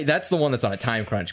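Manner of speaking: normal talking voice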